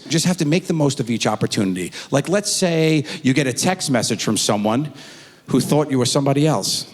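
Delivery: usual speaking voice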